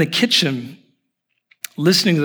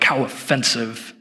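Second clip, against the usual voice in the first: slow, deliberate mock indignation; hammed-up offence, drawn out; mock offended; 3 dB quieter, slower than usual. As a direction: play an affronted Portlander, spacing out each word. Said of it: "waiting for her to go like".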